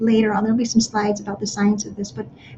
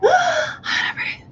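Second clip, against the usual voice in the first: gasping breath; slower than usual. Shown as a demonstration. Parent showing children a panicked gasping breath. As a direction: gasp in sharply before the words. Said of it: "we normally tend to gasp for air".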